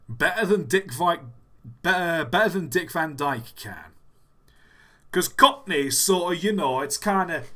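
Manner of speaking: put-on loud Cockney accent. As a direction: have a go at brash Cockney. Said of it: "can you do Cockney?".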